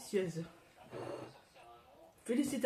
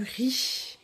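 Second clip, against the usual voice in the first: whisper; 6 semitones higher, 3 dB louder than usual. Real, not acted. Whispered aside at table.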